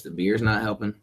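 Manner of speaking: ordinary talk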